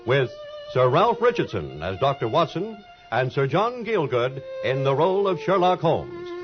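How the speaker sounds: ordinary talk, nothing marked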